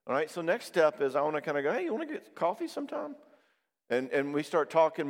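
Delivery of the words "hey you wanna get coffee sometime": much higher, bright hopeful ask; hopeful invite. Pretend. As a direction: pop the question like a nervous new friend. Inviting a new friend.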